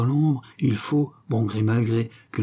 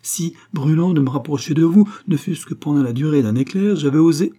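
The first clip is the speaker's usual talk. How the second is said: higher, louder impassioned outburst; beside himself; 5 semitones higher, 5 dB louder than usual. A narrator's reading. Suitor confesses to the lady, beside himself. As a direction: press on ardently, voice raised. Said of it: "s'écria Guérac hors de lui".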